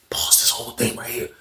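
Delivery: hushed, breathy aside; whispered aside. Real, not acted. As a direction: drop to a whisper.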